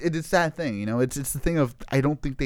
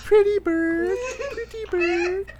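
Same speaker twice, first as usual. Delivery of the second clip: high squawky parrot voice, much higher; slower than usual. Pretend. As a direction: squawk it like a parrot through laughs. Parrot imitation, laughing.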